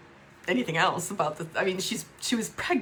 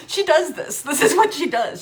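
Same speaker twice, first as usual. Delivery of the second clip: laughing through the words, louder; a pained laugh at it all; bitter laugh; 6 semitones higher, 8 dB louder than usual. Real, not acted. Describing her sister's pattern with a bitter laugh.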